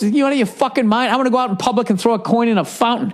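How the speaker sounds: high mock-outraged old man, fast. Old man refusing to flip the Super Bowl coin, high outraged scoff.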